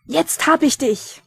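loud, high, drawn-out yell; full-voiced shout of capture; triumphant roar. A narrator's reading. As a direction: bellow it as you pounce on your quarry. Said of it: "brüllte er".

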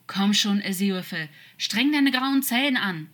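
pushy, urgent dare; challenging. A narrator's reading. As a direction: goad him into thinking. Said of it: "forderte er heraus".

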